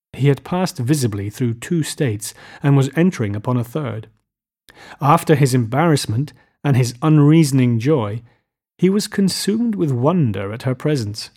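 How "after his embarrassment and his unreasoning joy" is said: usual speaking voice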